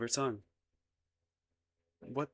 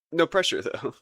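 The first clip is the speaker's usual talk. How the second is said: high, loud nervous blurt; flustered reassurance; 8 semitones higher, 8 dB louder than usual. Acted. Suitor backing off after confessing love, flustered. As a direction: jump in hastily to take the weight off.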